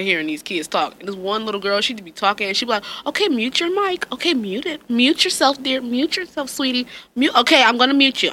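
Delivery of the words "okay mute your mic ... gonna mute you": high, sugary teacher voice; sweet teacher. Retold or imitated. Teacher to chatty kids on Zoom, high and sugary. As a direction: sweetly nag the kids to mute.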